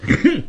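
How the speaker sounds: sharp scornful hmph, pitched high; a cold sneering snort. Acted. Bai Yutang scoffing at his sworn brothers, cold snort. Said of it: "白玉堂冷笑了一声".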